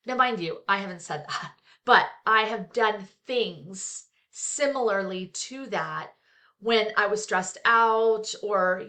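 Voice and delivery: ordinary talk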